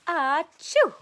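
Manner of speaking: loud, high acted sneeze; a big theatrical achoo. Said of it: "He sneezed".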